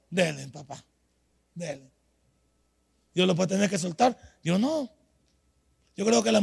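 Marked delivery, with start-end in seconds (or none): 0.1-1.9 low, soft letting-go; suddenly hushed and low after a shout; quiet dismissal. Acted. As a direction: drop right down and wave them off. Drops to dismissive permission.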